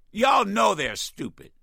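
ordinary talk, nothing marked

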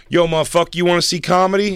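loud thuggy ticket seller; street hustler impression. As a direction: hawk tickets like a pushy street guy. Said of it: "my impression of it used to be".

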